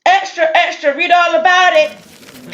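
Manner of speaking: loud high drawn-out street cry; newsboy calling out. Acted. Paperboy selling headlines to the town, loud slow cry.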